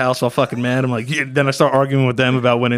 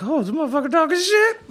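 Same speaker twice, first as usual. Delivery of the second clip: high-pitched mock outrage; put-on high voice for talking trash; 12 semitones higher than usual. Imagined YouTuber talking trash about another, high mock voice.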